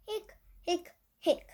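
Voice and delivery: high little hiccups; hiccup sounds. Acted. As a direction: hic out each one like a hiccup. Skeleton has the hiccups.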